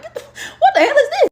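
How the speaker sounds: high, loud laughing outrage; shocked yell. Retold or imitated. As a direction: shout it in laughing disbelief. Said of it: "I'm like".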